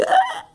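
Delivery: very high choking gasp; sharp gasp. Retold or imitated. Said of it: "when I was like".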